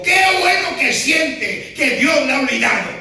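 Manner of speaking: high, loud preaching shout; pastor's shout. Retold or imitated. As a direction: proclaim it loudly.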